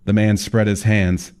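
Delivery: ordinary delivery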